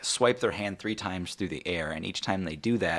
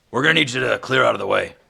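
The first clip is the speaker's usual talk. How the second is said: loud, fast, raised official call; commanding call; 6 semitones higher, 9 dB louder, faster than usual. Acted. Half-orc to onlookers in the way, commanding call. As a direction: call out to clear the area.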